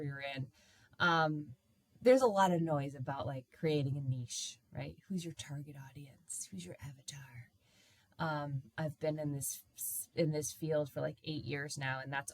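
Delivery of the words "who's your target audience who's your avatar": hushed mock-guru whisper. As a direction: whisper it like industry buzz. Mimics online business advice.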